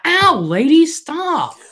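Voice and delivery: loud, high-pitched fake yelp; mock protest. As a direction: cry out as if a stranger is bothering you. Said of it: "I'm acting like I'm not".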